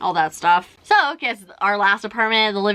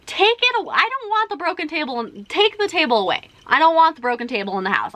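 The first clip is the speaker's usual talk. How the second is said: high, mildly irritated insistence; a lightly replayed demand to take it away; mock-firm "take it away", pitched high; retold irritation; 8 semitones higher than usual. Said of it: "I'm irritated".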